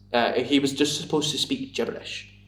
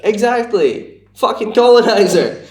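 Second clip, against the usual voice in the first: high, loud, drawn-out indignation; mock outrage; 9 semitones higher, 9 dB louder, slower than usual. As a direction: spit it out as hammed-up outrage. Mock outrage at being told of subtitles.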